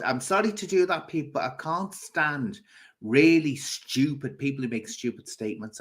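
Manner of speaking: ordinary talk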